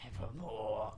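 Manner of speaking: whispered, eerie and breathless; spooky whisper. Pretend. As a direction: hush it like a ghost story. Echoing the raven spookily.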